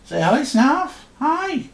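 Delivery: very high, cutesy cat-greeting voice; squeaky pet talk. Real, not acted. Getting the cat to say hi, squeaky pet voice.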